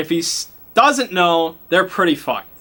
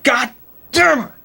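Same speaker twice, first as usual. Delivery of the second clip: exasperated shout; 9 semitones higher, 4 dB louder than usual. Acted. Party reacts to failed roll.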